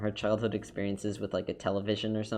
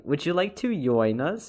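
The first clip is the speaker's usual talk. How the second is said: put-on slow, louder, higher Swedish-accented invitation, "join" said as "yoin"; 5 semitones higher, 5 dB louder than usual. Midsommar woman invites the main character, in a mimicked Swedish accent. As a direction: imitate a Swedish woman, J turned into Y. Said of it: "they pronounce it like a Y".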